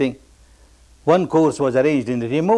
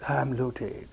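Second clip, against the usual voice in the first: imitated lament; 10 dB quieter, slower than usual. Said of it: "Keeps on crying".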